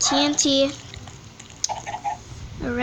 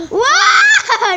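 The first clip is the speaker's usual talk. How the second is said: high startled gasp then yelp; surprised shriek with laugh; 10 dB louder than usual. Real, not acted. Sudden surprise scream.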